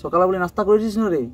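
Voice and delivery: ordinary delivery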